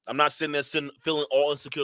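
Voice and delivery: usual speaking voice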